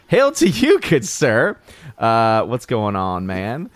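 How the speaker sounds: ordinary delivery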